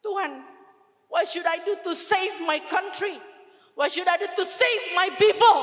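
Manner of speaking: high, anguished plea building to loud; wailing, desperate prayer; crying out to God. Retold or imitated. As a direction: beg God desperately, rising to a shout. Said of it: "Setiap hari hanya menangis".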